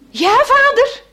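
put-on boy calling up to the fisherman; calling back. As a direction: shout back up to the hut. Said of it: "roept hij".

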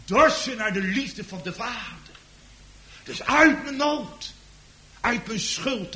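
high, loud pulpit build; preacher pleading with the congregation; fervent preaching. Acted. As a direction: press each phrase home. Preaching on thirst.

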